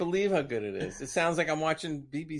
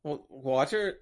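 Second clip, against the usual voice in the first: ordinary talk, nothing marked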